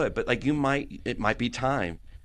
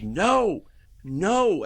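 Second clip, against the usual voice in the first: high, louder cry of refusal; retold scream; 10 semitones higher, 4 dB louder than usual. Dad retelling his daughter's reaction, imitated scream. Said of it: "she screamed".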